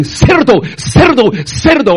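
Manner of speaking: preacher yelling cerdo at the crowd; retold shouting. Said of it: "And he was shouting".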